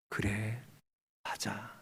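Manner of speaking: very soft, low, spaced words; hushed sorrowful confiding. Retold or imitated. God telling Hannah his own heart, hushed and low. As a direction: confide it quietly, as God admitting pain.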